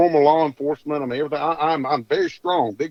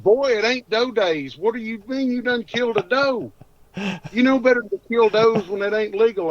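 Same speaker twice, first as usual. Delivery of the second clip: scolding dad; 8 semitones higher than usual. Retold or imitated. Angry dad scolding on phone.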